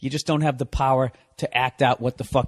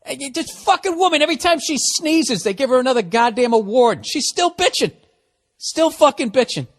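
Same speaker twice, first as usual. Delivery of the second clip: high, strained, indignant outburst; exasperated rant; 9 semitones higher, 4 dB louder than usual. Real, not acted. Ranter fed up with award-winning actress's complaining, exasperated. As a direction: let the complaint shoot up in pitch, incredulous.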